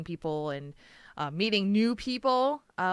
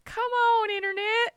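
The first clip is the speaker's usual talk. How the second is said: pleading. Real, not acted. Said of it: "pleading with dropped connection".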